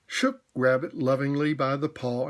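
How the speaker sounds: usual speaking voice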